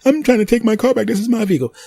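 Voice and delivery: high put-on woman's voice, protesting; whiny woman voice. Former owner pleading for her car back, high and whiny. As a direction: squeak out the protest.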